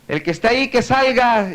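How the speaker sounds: high-pitched calling out; acted shout. Spooked man challenging a hidden mocker, acted shout. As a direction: call out high to someone hidden. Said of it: "gritó".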